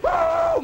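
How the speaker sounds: very high sustained "woo"; train whistle imitation. Train pulls out for the next fight city, whistle hoot.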